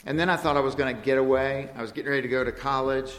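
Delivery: ordinary talk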